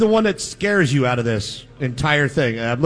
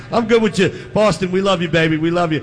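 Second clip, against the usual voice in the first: ordinary talk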